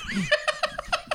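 high-pitched burst of laughter; high giggle. Real, not acted. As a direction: break into a giggle. Laughing at the joke.

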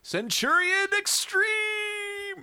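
slow, high, drawn-out TV promo shout; cartoon announcer shout. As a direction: belt it like an eighties cartoon intro.

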